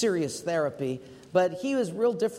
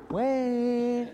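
pleading call; 6 semitones higher than usual. Retold or imitated. Mike calling again.

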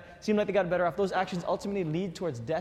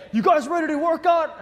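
much higher, loud hyped shout; buff trainer shout; 11 semitones higher, 8 dB louder than usual. Retold or imitated. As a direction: yell it like a fitness DVD coach. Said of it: "big buff dude. He's like".

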